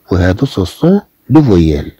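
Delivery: ordinary delivery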